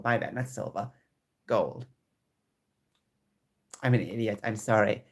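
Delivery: ordinary talk, nothing marked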